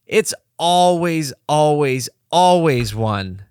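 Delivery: each always stretched out slow and leaned on; emphatic repetition. Real, not acted. Podcaster rousing listeners about hard work, slow emphatic build. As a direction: hammer the always, always, always home.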